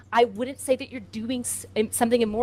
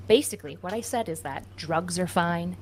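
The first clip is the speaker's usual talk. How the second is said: ordinary delivery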